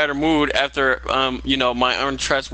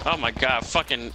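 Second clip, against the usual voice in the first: ordinary talk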